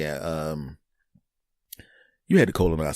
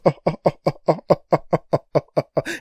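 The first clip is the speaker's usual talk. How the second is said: loud devilish laugh; evil clown cackle; 7 semitones higher, 4 dB louder than usual. Acted. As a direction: cackle like a movie villain in close-up. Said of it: "turned into an evil clown or a devil".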